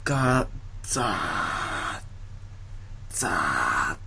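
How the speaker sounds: slow, drawn-out rushing noise; sustained "zaa" sound effect; imitated rice pouring.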